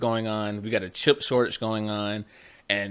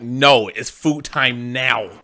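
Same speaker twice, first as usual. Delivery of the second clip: slow, loud demanding cat voice; snooty bored cat; 7 dB louder, much slower than usual. Retold or imitated. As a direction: drag it out like a bossy cat. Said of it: "his internal circadian rhythm is just like".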